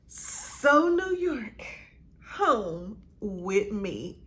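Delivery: very slow, savoring each word; drawn-out dramatic reveal. Real, not acted. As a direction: stretch every word out theatrically.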